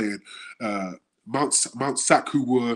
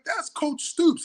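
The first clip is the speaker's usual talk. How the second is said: much higher, amazed reveal; incredulous; much slower than usual. Retold or imitated. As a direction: spell out the obvious in amazement. Coach stunned player doesn't know.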